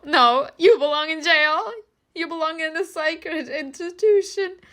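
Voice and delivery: high, sneering furious mother; shrill mocking retort. Mother throwing the jail taunt back, high and sneering. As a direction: fling it back high and snide.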